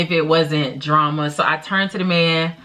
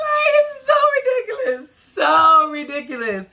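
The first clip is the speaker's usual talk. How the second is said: laughing at herself; 10 semitones higher than usual. Real, not acted. Laughing at her own story.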